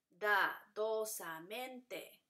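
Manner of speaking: dadosamente very slow, Da split from dosamente; syllable by syllable. Shown as a demonstration. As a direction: draw out every syllable of Da, dosamente. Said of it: "Entonces se divide". (Da, dosamente muy lento, sílaba por sílaba)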